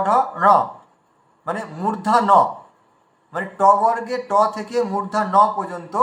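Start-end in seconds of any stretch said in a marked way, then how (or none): none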